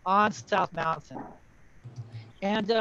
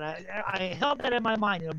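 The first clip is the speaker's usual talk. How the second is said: normal talking voice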